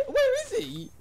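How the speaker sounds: very high, startled question; surprised. Real, not acted. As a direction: ask it in sudden shock. Friend suddenly appears.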